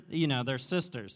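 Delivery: ordinary talk, nothing marked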